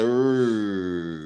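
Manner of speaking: long rolled growl imitating a stomach; stomach rumble. Hungry guest imitating his rolling stomach, rumbling growl. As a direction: roll out a drawn rumbling noise. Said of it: "My stomach a roll and I say".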